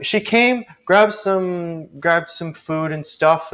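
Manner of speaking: high-pitched chef greeting; chatty chef character. Acted. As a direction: answer as the chef, pitched well up.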